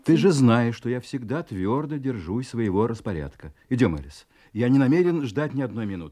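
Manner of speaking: loud cold order; menacing insistence. Acted. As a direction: force her with your will.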